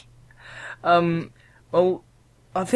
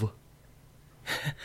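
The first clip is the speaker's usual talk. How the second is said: ordinary talk, nothing marked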